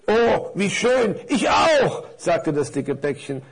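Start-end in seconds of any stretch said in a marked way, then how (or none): 0.1-2.0 delighted big parcel. Acted. Big parcel reacts gladly.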